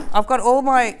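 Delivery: ordinary talk, nothing marked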